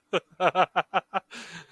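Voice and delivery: loud, high burst of laughter; busted-up laugh. Real, not acted. Player laughing at a video of a nephew, busted-up laughter. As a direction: crack up helplessly, loud and high. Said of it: "I busted up laughing".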